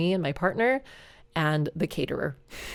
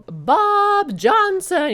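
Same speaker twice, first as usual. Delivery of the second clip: much higher, louder, very slow name reveal; announcer call; 13 semitones higher, 7 dB louder, much slower than usual. Retold or imitated. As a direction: stretch the winner's name out grandly. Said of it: "And the announcer said".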